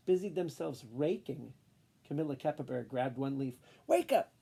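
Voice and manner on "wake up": high, loud yell. Acted. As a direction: yell at the leaf. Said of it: "she yelled".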